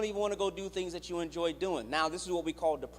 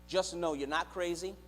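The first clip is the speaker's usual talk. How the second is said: ordinary talk, nothing marked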